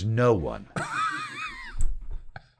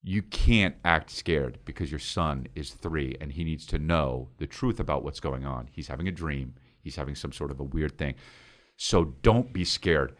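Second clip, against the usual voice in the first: low, hushed pep talk to himself; inner self-talk; 8 semitones lower, 8 dB quieter than usual. Retold or imitated. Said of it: "in my head, I say".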